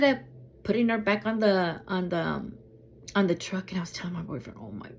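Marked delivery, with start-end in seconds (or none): 3.7-4.9 low-volume, whispery retelling; hushed. Retold or imitated. Telling her boyfriend about the dog, hushed. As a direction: drop to a near whisper.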